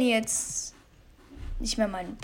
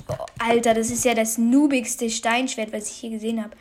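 ordinary talk

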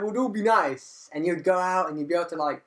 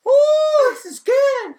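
much higher, louder, thrilled exclamation; delighted high-pitched whoa; excited whoa; 10 dB louder, much slower than usual. Retold or imitated. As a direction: gush over the fresh feeling, pitched way up. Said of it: "I was like".

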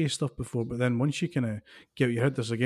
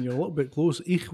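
normal talking voice

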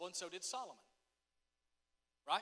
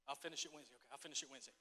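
quiet aside; 6 semitones lower, 10 dB quieter, much faster than usual. Real, not acted. Aside, dropping voice to congregation.

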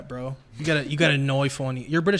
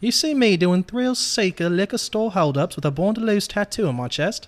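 put-on higher, challenging British-accented question; 6 semitones higher, 3 dB louder than usual. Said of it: "in a British accent".

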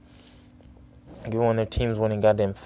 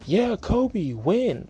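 mock fan cheer; 11 semitones higher, much slower than usual. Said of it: "we're just like".

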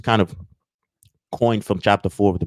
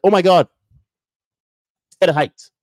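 mock panic; 8 semitones higher, 12 dB louder than usual. Mocking fearful people.

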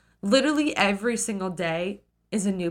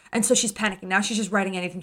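normal talking voice

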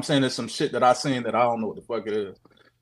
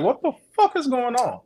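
high, incredulous retold outburst; baffled disbelief; 11 semitones higher than usual. Man retells lifting his shades and seeing nothing, baffled disbelief. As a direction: pitch leaps up in bewilderment.

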